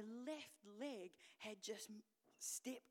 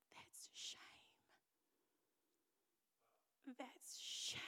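soft, whispery, weighted pronouncement; hushed verdict; 3 semitones higher, 4 dB quieter, much slower than usual. Retold or imitated. Leader gently naming woman's shame, hushed. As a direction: say it hushed, letting it sink in.

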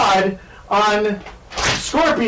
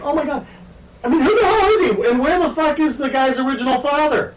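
mock outrage; 8 semitones higher than usual. Mock outraged rant at movie.

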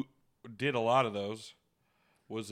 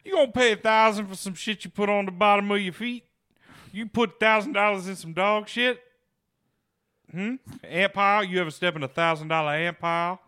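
high, loud incredulous scolding; mock outrage; 9 semitones higher, 7 dB louder than usual. Scolding a buyer over thousand-dollar shoes, in loud mock outrage. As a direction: berate them in high, loud disbelief, hammed up.

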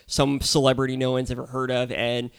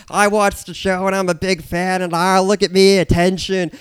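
high, loud attention-seeker mimicry; hammed-up clamoring fan voice; mock celebrity fan; 7 semitones higher, 7 dB louder than usual. Podcaster mocking celebrity guests who just want attention, hammed-up mimicry. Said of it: "They're just like".

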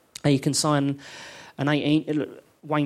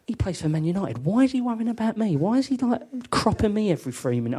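baffled; 6 semitones higher than usual. Retold or imitated. Inner thoughts, baffled.

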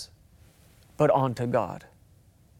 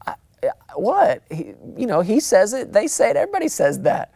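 put-on flustered kid; 6 semitones higher, faster than usual. Kid stammering excuses.

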